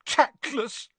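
put-on high, wounded ghost-girl voice; piqued, high-voiced reproach; offended mutter. A narrator's reading. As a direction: say it hurt and offended, pitched high. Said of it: "she muttered".

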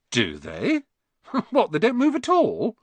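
a laughing, rising disbelief; amazed. Acted. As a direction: Ron can't believe photos stay still. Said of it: "Ron sounded amazed".

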